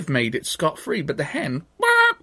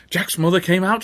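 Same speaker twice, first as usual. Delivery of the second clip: usual speaking voice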